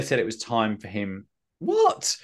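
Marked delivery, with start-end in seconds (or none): 1.6-2.2 surprised. Real, not acted. Stumbling over own recap.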